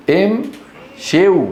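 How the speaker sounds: امشوا split slowly as "im... shiw"; wrong form sounded out. Shown as a demonstration. Teacher showing a form nobody says, sounded out. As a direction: drag out امشوا with i before w. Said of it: "ما أحد يقول امشوا".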